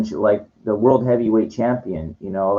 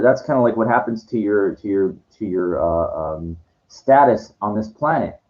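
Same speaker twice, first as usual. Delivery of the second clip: ordinary delivery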